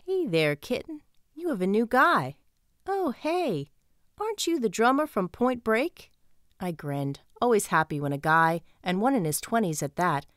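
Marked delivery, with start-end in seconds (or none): 0.1-2.4 lightly voiced Southern bouncer; Southern accent. A narrator's reading. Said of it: "deep Southern accent". 2.9-6.1 surprised recognition. A narrator's reading. Bouncer recognizes the drummer.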